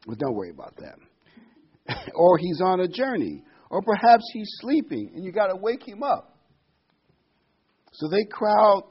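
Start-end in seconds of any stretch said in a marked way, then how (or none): 2.1-6.4 mocking taunt. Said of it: "Elijah mocked them and said".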